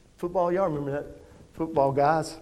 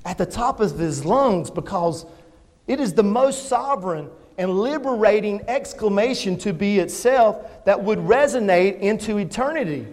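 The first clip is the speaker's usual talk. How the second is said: ordinary delivery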